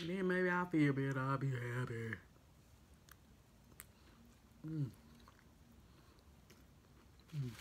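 humming while eating; savoring a bite; chewing with mouth full. Real, not acted. Seen 4: Face clear; bites food, chews with mouth full throughout the hums.

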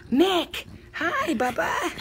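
high-pitched hello to a dog; high pet-greeting call. Real, not acted. Owner greeting the puppy Bubba, high-pitched call.

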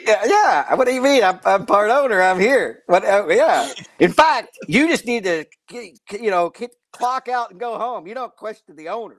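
brash, loud mock tycoon bluster; loud mock owner. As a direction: boss them around, loud and cocky. Acting the part owner, loud.

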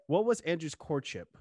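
ordinary talk

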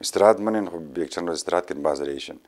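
ordinary delivery, nothing marked

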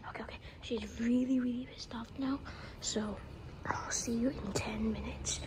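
hushed whisper near target. Real, not acted. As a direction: secret prankster whisper. Whispering to camera.

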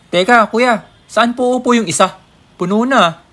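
a flustered rider pushing back; protesting passenger. Acted.

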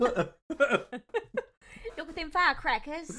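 put-on loud, high, wayward South African-ish accent; botched British accent, South African-sounding. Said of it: "South African much?".

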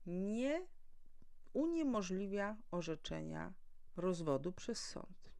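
ordinary delivery

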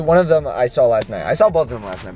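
normal talking voice

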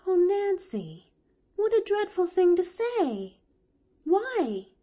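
high-pitched dismayed exclamation; scandalized little girl, pitched high; shocked young girl. Acted. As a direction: gasp it out in a high girl's voice. Pollyanna shocked at Nancy's words.

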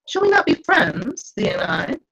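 coaxing spirit, sliding high to win her over; wheedling. A narrator's reading. Spirit Ariel coaxing Miranda to be friends, wheedling. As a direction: sweet-talk her like a sly spirit. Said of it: "His voice takes on a wheedling tone".

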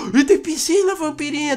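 thrilled to find a pool; excited gasp. Real, not acted. Kid showing the vampire girl the pool, excited.

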